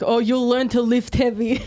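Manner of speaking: half-mocking impression of the trainer; imitating coach. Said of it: "Is that how I sound?".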